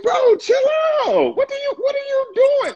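exasperated shout. Retold or imitated. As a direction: shout it, fed up with being followed. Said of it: "They be like".

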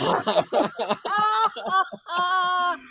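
a long high laugh. Real, not acted. After a joke about a rival journalist, long high laugh. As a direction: break up at your own joke.

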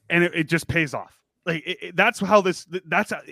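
ordinary talk, nothing marked

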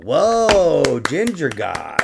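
slow, drawn-out awed whoa; hammed-up wonder; mock awe. Mock awe at ginger god.

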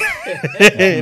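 loud, high incredulous laugh; disbelieving laugh. Retold or imitated. Retold call, laughing in disbelief.

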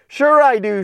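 high, glad answer; keen to give a good answer; eager puppy. Acted.

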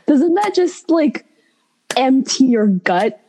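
high, emphatic reaction; gushing after the story; excited. Real, not acted.

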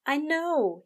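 high-pitched sympathetic agreement. A narrator's reading. Aziraphale comforts Crowley over his nightmare charge, sympathetic. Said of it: "he said sympathetically".

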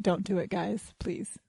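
pleading hushed whisper. Acted. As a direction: whisper it like a plea. Pleading fashion warning whisper.

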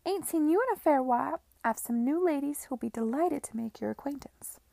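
high, loud cockney brothel keeper, all welcome; loud, high, pushy landlady voice; brassy madam. Acted. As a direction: sell him the new girls with a broad grin.

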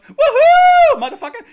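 high, loud laughing woohoo; whooping cheer. Real, not acted. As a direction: scream it with joy. Celebrating text reply.

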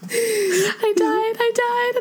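very high, giddy fangirl squeak; giddy squeal. Retold or imitated. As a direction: squeak it like an overwhelmed fan. Excited fan retelling reaction.

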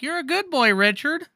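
high, sing-song voice; high chanting praise; creepy repeated phrase. Retold or imitated. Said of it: "but he'd be like".

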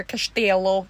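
"castello" with a sh sound, "cash-tello"; Portuguese pronunciation. Shown as a demonstration. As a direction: say "castello" as "kash-TEL-oo" like a local. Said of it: "That's how they would say it".

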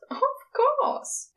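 a hint of a chuckling angel; amused chuckle. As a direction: let the laugh lift the words. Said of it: "Aziraphale chuckles".

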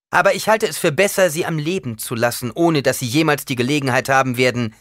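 forceful threat. A narrator's reading. Paul warning the old woman, forceful and loud. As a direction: lay it down forcefully.

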